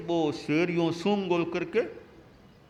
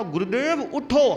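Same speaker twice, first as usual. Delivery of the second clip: louder, higher call to wake him; raised urgent plea to the sleeping master; 5 semitones higher, 5 dB louder than usual. Retold or imitated. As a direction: shake him awake with your voice. Disciple shakes guru, calls again.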